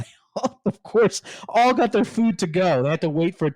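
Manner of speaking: can't keep from laughing as you tell it; laughing through it. Real, not acted. Telling the host how the agents fled, laughing. Agents rushing out with doggy bags.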